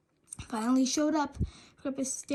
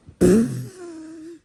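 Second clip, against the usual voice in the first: loud laughter; 2 semitones higher, 8 dB louder than usual. A narrator's reading. Boys laughing hard.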